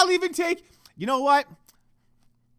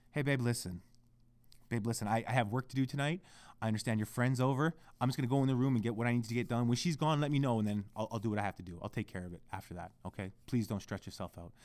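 much lower, hushed, careful request; very polite; 12 semitones lower, 11 dB quieter than usual. Retold or imitated. Boyfriend asking fiancee for space to work, overly polite. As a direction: ask softly, as if handling delicate feelings. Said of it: "So you asked very politely".

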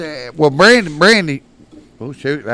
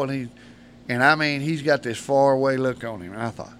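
ordinary delivery

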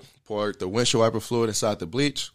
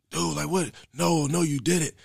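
higher, startled friend at the door; hint of a panicked friend; alarmed roommate; 7 semitones higher than usual. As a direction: voice him stunned and pushing back. Roommate finds him after overdose.

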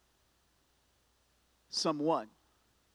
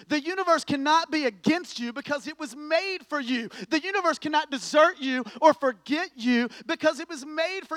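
loud, higher, driving preacher voice; preaching at full force; 9 semitones higher, 9 dB louder than usual. Acted. Preacher mocking complaints against the universe, loud. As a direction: preach it out with fire.

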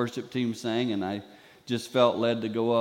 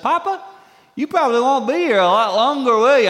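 put-on high, bright-eyed little boy asking, louder; grandson's question; 12 semitones higher, 10 dB louder than usual. Grandson asking Papa at the birthday dinner, high child voice. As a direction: voice a small grandkid, pitch way up. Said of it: "one of the boys popped up and said".

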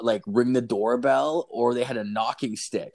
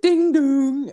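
high, loud sung ding dong; doorbell imitation; 6 dB louder than usual. Telling a friend the doorbell rang, imitated chime. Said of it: "all of a sudden, it's like".

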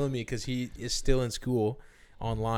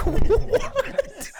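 much higher, louder laughing disbelief; shocked what; 7 dB louder than usual. Retold or imitated. Hearing the rude lady's remark about phones, laughing shocked disbelief. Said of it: "And I was like".

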